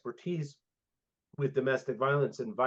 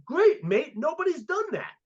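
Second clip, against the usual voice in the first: imitated Australian accent, pitched well up; a bright imitation of an Aussie mentor cheering; 13 semitones higher, 3 dB louder than usual. Imitating his Australian mentor's reply in an Australian accent. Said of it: "Australian accent".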